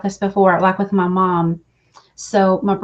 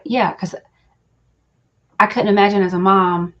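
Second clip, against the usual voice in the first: usual speaking voice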